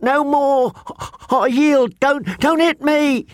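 high, shrill desperate begging; terrified pleading. A narrator's reading. Fat boy on ground begs knights to stop, shrill terrified plea. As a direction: beg for your life in panic.

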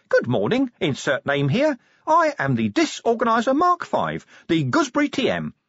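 a tiny eager machine-imp rattling off its greeting; chirpy imp. Acted. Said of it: "it began, speaking fast".

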